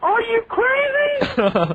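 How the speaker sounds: very high, slow, disbelieving squeal; incredulous. Retold or imitated. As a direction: drag it out in shocked disbelief. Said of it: "I can remember saying, "Are you crazy?".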